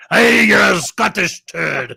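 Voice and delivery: shouted mock Scottish insult, slow; mock Scottish accent. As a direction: bellow it in a hammed-up Scottish accent.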